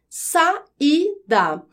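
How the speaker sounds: "saída" broken into sa, í, da; syllable by syllable. Shown as a demonstration. Teacher giving a hiatus example, syllables separated. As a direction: separate A and I in "saída" with clear gaps. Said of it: "o A fica em uma sílaba".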